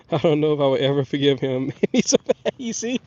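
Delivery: laughing at his son for making him sick. Real, not acted. Laughing about his son getting him sick. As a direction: chuckle it out.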